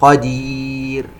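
slow "قدير" with long ee and thin final r; light final raa. Shown as a demonstration. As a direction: chant "قدير", keeping the r light. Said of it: "يجب أن ترقق". (ترقيق راء "قدير" عند الوقف)

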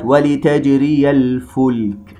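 slow melodic tajweed recitation; Quran recitation. Shown as a demonstration. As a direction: chant the verse slowly with tajweed.

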